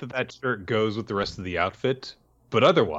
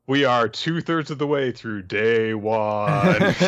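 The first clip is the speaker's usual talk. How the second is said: loud, drawn-out proclamation; mock announcement; 3 semitones higher, 7 dB louder, slower than usual. Podcaster announcing how little the story has moved, mock-grand. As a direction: announce it with exaggerated slow grandeur.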